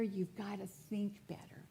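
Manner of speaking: soft closing whisper. Real, not acted. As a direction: drop to a whisper on the last line. Quote read, closing line whispered.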